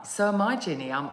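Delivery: ordinary talk, nothing marked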